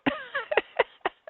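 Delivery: a high, far-off giggling laugh; high giggle. Real, not acted. Host knocking on wood for listeners, high giggle. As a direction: giggle high at your own superstition.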